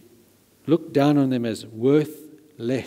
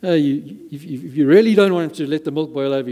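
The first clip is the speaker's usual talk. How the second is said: normal talking voice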